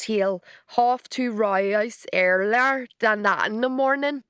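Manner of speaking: a bad, slow attempt at a Derry accent; American actress mangling a Derry accent; terrible Derry accent. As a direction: ham up a garbled Northern Irish lilt. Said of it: "one of the worst attempts at his accent".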